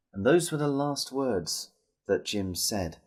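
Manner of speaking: plain reading voice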